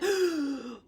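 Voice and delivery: sharp demonstrated gasp of fear; scared gasp. Shown as a demonstration. Asking viewers about fasting fear, a mock scared gasp. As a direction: gasp as if dread just hit. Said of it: "do you get that, like".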